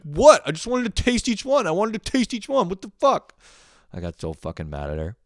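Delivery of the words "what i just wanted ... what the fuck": high, loud indignant sister voice; sister's whiny reply. Retold or imitated. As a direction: whine back defensively. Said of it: "She's like".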